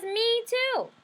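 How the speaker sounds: high exuberant little sister. Acted. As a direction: squeal it like the peak of the story. Climactic refrain of the story.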